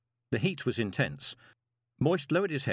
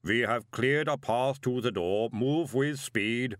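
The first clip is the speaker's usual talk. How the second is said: golem voice; slower than usual. A narrator's reading. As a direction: speak slow and heavy like a golem. Golem Mister Pump speaking.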